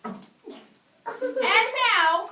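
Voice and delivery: high, loud, laughing call; excited cry. A narrator's reading. Teacher as Max starting the rumpus, excited cry. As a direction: burst out like a thrilled boy king. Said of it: "cried Max".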